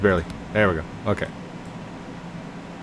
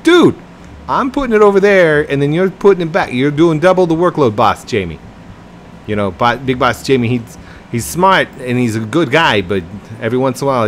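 loud, hammed-up Boston accent; exasperated mock-Boston worker, loud and raised; bad Boston accent; 5 semitones higher, 7 dB louder than usual. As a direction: rant in a badly faked Boston accent. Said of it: "that's not even a good Boston accent".

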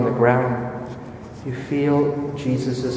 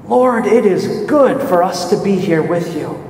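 higher, louder joyful cry; joy and excitement; 5 semitones higher, 4 dB louder than usual. Acted. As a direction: Peter overjoyed to be there. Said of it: "says with joy and excitement in his voice".

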